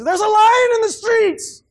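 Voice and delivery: high, loud, slow mocking whine of a lazy excuse; shrill-free mock whine, high and loud; whiny excuse-maker. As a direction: ham up the lazy man's excuse. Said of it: "more excuses".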